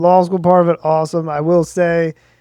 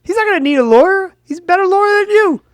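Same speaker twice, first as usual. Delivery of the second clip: higher, quick exasperated comeback; indignant retort; 10 semitones higher, faster than usual. Retold or imitated. Telling the boyfriend off, quick and exasperated. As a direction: fire back in disbelief. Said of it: "I'm like".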